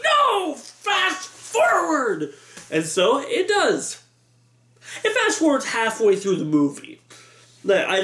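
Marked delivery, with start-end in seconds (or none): 0.0-2.3 very high, stretched-out crowd holler; mock yell. Audience seeing the logo restart, mock yelling in protest. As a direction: protest in a drawn-out, held-back shout.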